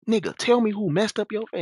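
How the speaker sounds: high-pitched mimicry of an angry man; imitated heated demand. Neighbor pressing his brother for a name, imitated heated demand. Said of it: "like, he heated".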